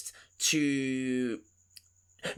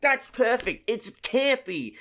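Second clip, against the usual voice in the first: excited; 9 semitones higher, 3 dB louder, slower than usual. Real, not acted. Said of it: "excited agreement".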